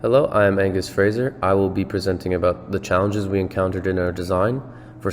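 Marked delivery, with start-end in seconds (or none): none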